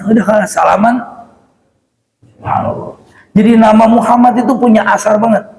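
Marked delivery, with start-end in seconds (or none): 2.3-3.0 a soft breathy subhanallah; whispered awe. Real, not acted. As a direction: breathe it in wonder. Kagum setelah cerita. (bisikan kagum)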